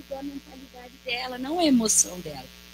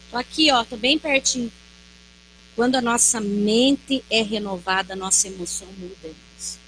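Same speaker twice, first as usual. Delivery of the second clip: ordinary talk, nothing marked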